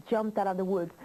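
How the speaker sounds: plain reading voice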